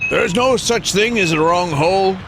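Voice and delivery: bad, high put-on movie-villain voice; Willem Dafoe impression. Streamer doing a self-admitted terrible Lighthouse impression, high and hammed up. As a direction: ham up a manic Lighthouse sailor. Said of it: "my attempt at a Willem Dafoe impression".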